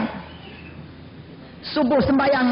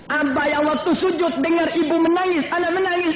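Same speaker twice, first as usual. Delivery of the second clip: ordinary talk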